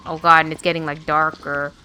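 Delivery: alarmed as the room goes dark; spooked. Real, not acted. Player spooked by darkening scene, loud nervous exclaim.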